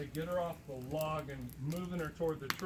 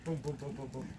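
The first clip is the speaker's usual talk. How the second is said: rapid low percussive repetitions; imitated knocking; 3 semitones lower, 5 dB quieter than usual. Imitating sounds heard from inside the tree, rhythmic knocking. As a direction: lightly mimic knocks and booms as sound effects. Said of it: "you hear from inside the tree".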